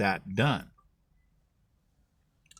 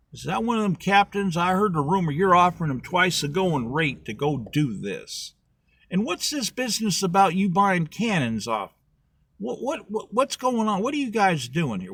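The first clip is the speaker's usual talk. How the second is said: agitated questioning; 8 semitones higher, 3 dB louder than usual. Acted. Agitated official questioning the scheme.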